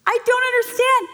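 very high, hammed-up wail; mock-frantic mom. New mother protests to her mentor, mock-tearful panic.

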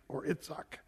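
Itzhak whispered as a soft aside; Hebrew name aside. Shown as a demonstration. Preacher whispers the Hebrew name after Isaac. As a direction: slip in Itzhak under the breath. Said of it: "thou shalt call his name Isaac".

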